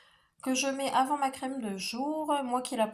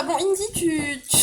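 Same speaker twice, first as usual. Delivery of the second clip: scolding the dog; 6 semitones higher, 8 dB louder than usual. Real, not acted. Scolding dog climbing tree.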